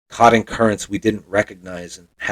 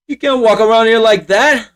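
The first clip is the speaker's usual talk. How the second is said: high, louder mock-gruff telling-off; gruff Italian dad scolding; 11 semitones higher, 7 dB louder than usual. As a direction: scold him like the strict old-school dad. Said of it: "And he goes".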